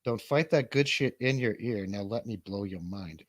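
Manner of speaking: ordinary talk, nothing marked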